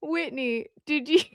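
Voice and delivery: squealing, high, through laughter; laughing in disbelief. Real, not acted. Reacting to Whitney's chat post, laughing helplessly. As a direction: laugh so hard the words crack.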